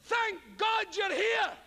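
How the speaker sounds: slow, high, booming relief; hammed-up greeting shout. Shown as a demonstration. Actor bursting in, hamming the relieved greeting shout. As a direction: bellow it like a grand stage entrance. Actor's overblown entrance line.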